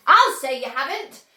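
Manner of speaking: loud indignant cry. A narrator's reading. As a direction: snap back in outrage. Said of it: "cried Missus Beauregard".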